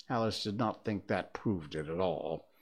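plain reading voice

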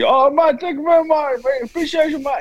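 over-the-top grateful coworker; imitating coworker. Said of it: "I showed him the same thing".

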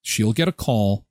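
ordinary talk, nothing marked

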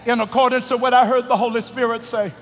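low soft altar call; prayer voice. Acted.

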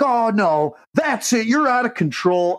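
high-pitched, indignant imitation; mock scolding bar worker. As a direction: mimic an officious little guy telling someone off. Bar worker scolding him, retold.

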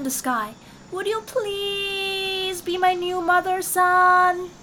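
high, loud kitten call up to the sky; kitten calling loudly. Acted. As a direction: shout up to the sun in a high kitten voice. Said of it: "called loudly".

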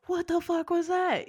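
high-pitched hammed-up bewilderment; mock startled. Bus passengers hearing something land on top, high mock alarm. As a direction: imitate a baffled, startled passenger.

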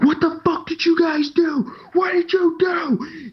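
high, frantic shriek, the last words dragged slow; panicked yell. Retold or imitated. Intelligence officer on the walkie-talkie, panicked shriek. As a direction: freak out at whoever cut the power. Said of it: "He's like".

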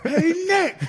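high, bright-voiced hail to the coach; a big lineman hollering for his head coach; calling out. A veteran lineman calls out to Nick Saban, a cheerful hail.

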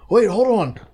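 high, pleading wait-hold-on; high mock panic; mock urgent plea. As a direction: beg them to hold off falling, hammed-up.

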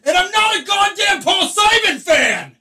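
shrill, high mock outburst; angry shout. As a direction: yell it in comic rage. Mock outburst about [name].

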